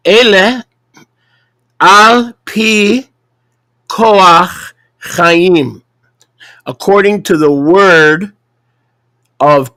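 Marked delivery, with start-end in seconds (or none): 0.1-5.8 slow, syllable by syllable, higher; over enunciating. Shown as a demonstration. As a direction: spell out each word slowly with pauses. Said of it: "Let me say that slowly".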